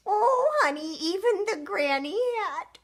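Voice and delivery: high, loud, put-on granny; storybook old lady exclamation. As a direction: exclaim as a delighted granny, hammed-up. Granny voice for the granny hat.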